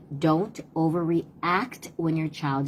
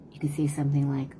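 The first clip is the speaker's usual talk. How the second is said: normal talking voice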